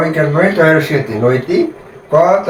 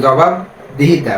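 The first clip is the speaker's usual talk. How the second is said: slow, জবাব then a break before দিহিতা; gap between parts; much slower than usual. Shown as a demonstration. Teacher demonstrates the gap to students, slow with a break. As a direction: pause after জবাব inside জবাবদিহিতা. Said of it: "দুইটার মাঝখানে একটা gap থেকে যায়".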